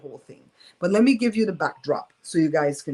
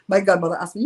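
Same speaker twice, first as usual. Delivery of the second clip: ordinary talk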